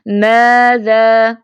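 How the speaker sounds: "ماذا" recited very slowly, thin long "aa" held level; thin-letter elongation. Shown as a demonstration. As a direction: hold the alifs of "ماذا" with a light vowel. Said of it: "اَ کا پیچھے sound ہوگا". ("ماذا" باریک آواز میں کھینچ کر)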